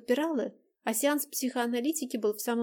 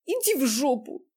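angry; 4 semitones higher, 5 dB louder than usual. Acted. Upset friend lashes out.

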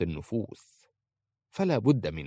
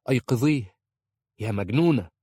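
louder impatient order; urgent demand; 3 semitones higher, 5 dB louder than usual. A narrator's reading. Aisha ordering the wife to wake the sheikh, impatient. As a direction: push the command out insistently.

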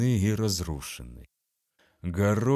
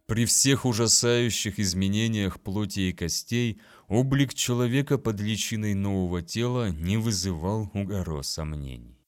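usual speaking voice

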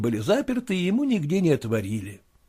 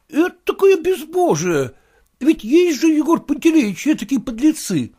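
much higher, louder outraged clerk; indignant clerk; 14 semitones higher, 5 dB louder than usual. Acted. The clerk sympathizes with his master, indignant. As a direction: play up the outrage to please the boss.

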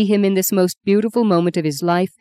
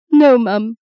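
higher, louder tearful refusal; 5 semitones higher, 5 dB louder than usual. Acted. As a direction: refuse mum through tears. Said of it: "the tears rolling down her skin".

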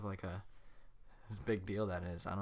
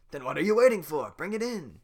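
impatient command; 7 semitones higher, 10 dB louder than usual. Acted. Farquaad ordering guards.

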